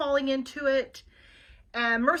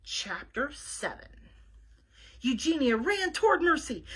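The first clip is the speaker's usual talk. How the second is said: ordinary delivery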